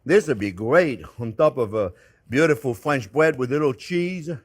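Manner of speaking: normal talking voice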